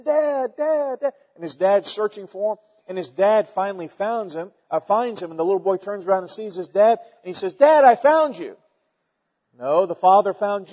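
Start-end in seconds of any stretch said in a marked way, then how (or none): 0.0-1.1 put-on high, plaintive child calling dad; a lost little boy calling out for his father; crying for dad. Lost boy in the woods calling for his dad, high plaintive cry. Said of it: "he's crying". 7.5-8.6 put-on little boy voice. Little boy finds his dad.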